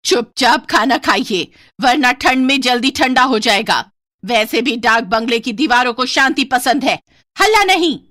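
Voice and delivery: put-on loud, stern old servant woman warning the family; stern old cook. (बूढ़ी काकी की सख्त, ऊँची चेतावनी)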